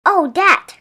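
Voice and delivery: bright sudden exclamation; cried out. A narrator's reading. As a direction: brush the sign aside eagerly. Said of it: "cried Bear".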